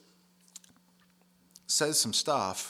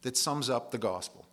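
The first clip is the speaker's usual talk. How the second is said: ordinary talk, nothing marked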